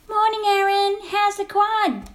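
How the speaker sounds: concerned colleague voice. Acted. Sketch take, concerned greeting.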